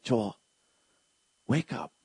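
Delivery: hushed divine nudge; God's quiet voice. Retold or imitated. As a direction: speak it gently like a whisper inside. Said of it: "He's like".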